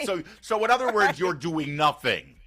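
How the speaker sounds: a high sarcastic "so in other words"; sarcastic retort. Retold or imitated. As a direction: scoff at the Europeans with sarcasm. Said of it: "It's like".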